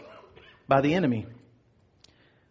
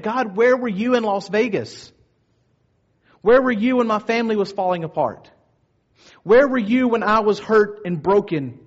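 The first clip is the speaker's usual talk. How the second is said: higher, raised voice, imitating an anguished demand; crying out at God; 5 semitones higher, 4 dB louder than usual. Preacher voices hurting people questioning God, crying out. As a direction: plead upward with force, question after question. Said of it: "she's crying out".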